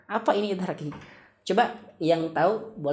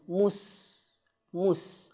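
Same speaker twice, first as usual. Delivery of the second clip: ordinary delivery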